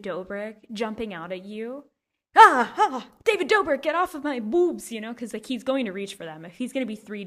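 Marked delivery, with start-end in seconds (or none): 2.4-4.9 loud high mock shriek at him; high yelped mock protest. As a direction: shriek him away in jest. Mock outburst at imagined 3D Dobrik.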